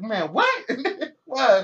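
high, loud mock outrage through laughter; movie character's disbelief. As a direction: act out a baffled comeback, cracking up. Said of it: "He's like".

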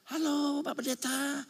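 butter up the pastor sweetly; fawning greeting. Retold or imitated. Rich man greets the pastor. (jemaat kaya menyapa pendeta dengan nada menjilat)

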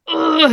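an exasperated "ugh", imitating an angry child; imitated furious grunt. Mother imitating her furious five-year-old, mock angry growl. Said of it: "He was so furious".

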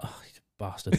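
under the breath, whispered; whispered aside. Retold or imitated. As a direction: whisper it to yourself. Muttered aside in story.

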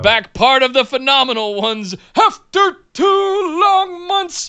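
high, slow wrestling promo voice; The Rock voice. Acted. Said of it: "In your best The Rock voice".

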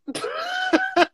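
high-pitched burst of laughter. Real, not acted. Laughing after own quip.

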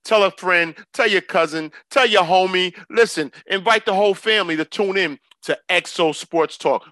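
loud hype show outro; announcer sign-off. Acted. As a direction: pump up the audience goodbye.

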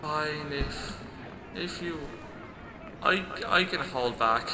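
soft, reluctant, halting voice; sand-on-glass voice. Acted. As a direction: give in quietly, haltingly. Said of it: "like sand on glass, it sounds like".

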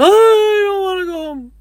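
high mock wail of protest; crying. Crying woman refuses a ride home, hammed-up wailing. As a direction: whine it out in an exaggerated bawl. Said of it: "she's just crying".

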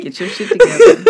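high, loud, laughing tease; laughing scolding. Real, not acted. Co-host teasing the host over blown takes, laughing. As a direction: scold through real laughter.

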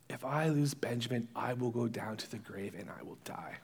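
low, soft, sinking voice; given up hope. Retold or imitated. Jacob to his sons about Benjamin, hopeless. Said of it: "he's given up hope".

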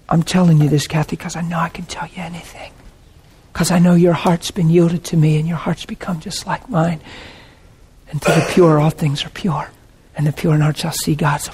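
soft, hushed intimate whisper. Retold or imitated. God whispering to a yielded daughter. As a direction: lean in and whisper it close. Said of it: "he whispers to you".

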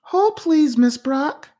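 high, polite phone receptionist greeting a caller. A narrator's reading. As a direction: pitch it up like a polite secretary answering. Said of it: "Patty replied".

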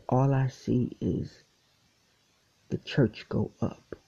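ordinary delivery

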